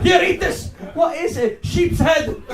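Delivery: put-on loud, high shouted Icelandic accent; booming Icelandic host, every phrase a shout. Icelandic family welcomes a visitor, shouting in Icelandic accent. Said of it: "if she visited my family this summer".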